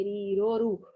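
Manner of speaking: normal talking voice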